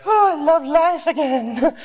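high, slow, drugged-happy sigh; blissed-out. Retold or imitated. Wife just given her epidural, blissed-out and high-pitched. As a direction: float it out in dreamy relief. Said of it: "gives her the happy juice".

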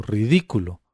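plain reading voice

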